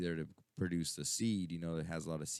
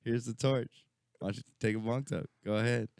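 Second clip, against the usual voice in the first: sly coaxing; 7 semitones higher, 4 dB louder than usual. Retold or imitated. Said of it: "I'm like".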